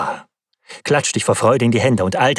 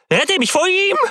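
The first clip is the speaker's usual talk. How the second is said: much higher, shrieking cry for help; terrified shriek. A narrator's reading. Viktor shrieks at his friend to save him from the monster, terrified scream. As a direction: scream it in sheer terror, escalating. Panicked plea for rescue.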